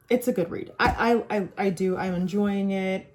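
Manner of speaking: normal talking voice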